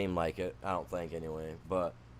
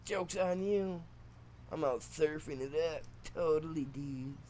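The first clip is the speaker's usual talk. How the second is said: higher-pitched put-on surfer voice; mock surfer dude; 6 semitones higher than usual. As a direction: read a farewell note as a goofy surfer. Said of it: "This sounds weird trying to talk like them".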